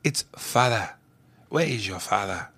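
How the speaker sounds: low, soft African-accented demo. Shown as a demonstration. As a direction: African accent, "father" as "fah-dah" with no r. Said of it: "That's how you say African accent".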